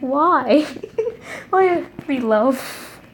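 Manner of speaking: high, giggling why; amused, high-pitched groan at the plot; laughing protest. Real, not acted. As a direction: laugh through the complaint. Reader reacts to story twist.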